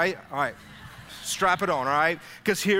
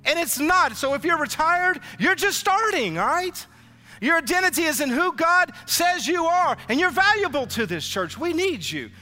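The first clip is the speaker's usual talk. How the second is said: high, loud rallying exhortation; preaching at full force; 8 semitones higher, 3 dB louder than usual. Real, not acted. Pastor urging retired members that the church needs them, fervently. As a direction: fire up the retirees in the pews.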